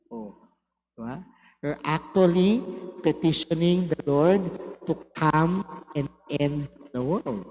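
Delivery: ordinary talk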